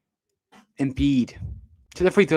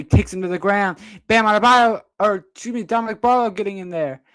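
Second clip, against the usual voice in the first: raised pitch, urgent play-by-play; animated live sports call; excited scramble call; 5 semitones higher, 4 dB louder than usual. Real, not acted. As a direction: jump on the sudden tussle, then keep it high. Calling a scramble for ball.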